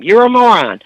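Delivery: high, loud mock yell; imitating mom yelling the insult; shouted insult. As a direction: shout it at your daughter. Mother repeats the insult.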